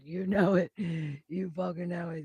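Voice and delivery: loud, fired-up cheer; big triumphant yell; hyped cheer. Real, not acted. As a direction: shout it out, pumped. Hyped card reading, cheering.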